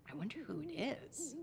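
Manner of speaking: ordinary delivery, nothing marked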